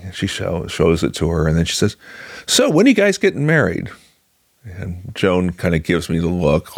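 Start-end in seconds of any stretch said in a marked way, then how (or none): 2.4-3.9 high, imitated chirpy question; mock eager questioning. Director's wife quizzes engaged couple, high and eager. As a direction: pitch it up like a keen friend asking.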